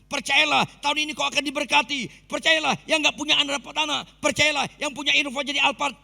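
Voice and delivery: a hint of a slick motivational preacher; mocking a motivator. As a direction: parody a hype speaker promising blessings. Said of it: "Itu motivator".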